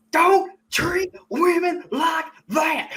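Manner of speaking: high, very slow, hammed-up drawl; Nick Cage. Fan showing why his lines work, exaggerated accented impression. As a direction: shout it word by word in a put-on movie accent. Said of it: "'cause all of his lines".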